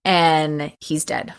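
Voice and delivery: normal talking voice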